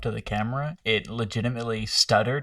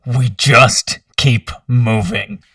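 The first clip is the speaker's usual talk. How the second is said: forceful order; 16 dB louder, much slower than usual. Acted. Player speaks as character.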